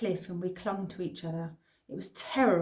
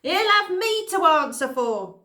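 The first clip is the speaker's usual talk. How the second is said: put-on indignant old man; 9 semitones higher, 9 dB louder than usual. Man continues his quote.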